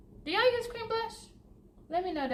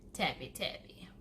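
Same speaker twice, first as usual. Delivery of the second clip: playful whisper; 7 semitones lower, 7 dB quieter than usual. Real, not acted. Playful tapping on brows.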